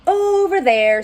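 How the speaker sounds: high, loud little bear answer; Little Bear's voice. Acted. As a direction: call it out like a small bear pointing. Bear answers Frog in story.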